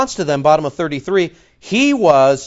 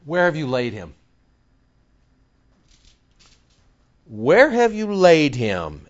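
indignant. Acted. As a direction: press them with restrained indignation. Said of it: "The Greek says he was indignant".

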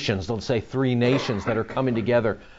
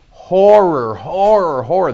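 horrified repetition; 6 semitones higher, 6 dB louder than usual. Real, not acted. Preacher dwells on the carnage.

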